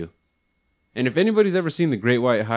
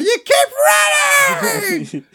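much higher, louder, slow drawn-out yell; psycho shout; 6 dB louder, much slower than usual. Retold or imitated. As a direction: scream it at him like a crazed woman with a bat. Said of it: "she's just like".

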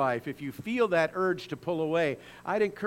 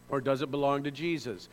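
ordinary delivery

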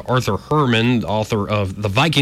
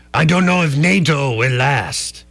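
mock French accent; 3 semitones higher than usual. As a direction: sulk like a French diplomat.

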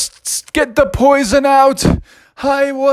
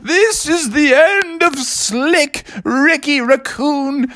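very high, slow melodramatic wail; moaning raccoon; 14 semitones higher, much slower than usual. Acted. Bitten raccoon lamenting his end, wailing. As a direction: moan out a hammed-up deathbed lament. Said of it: "a moaning raccoon going".

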